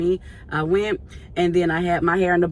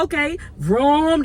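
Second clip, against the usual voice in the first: playful engine vroom; 8 semitones higher, 3 dB louder, slower than usual. Retold or imitated. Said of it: "I was like".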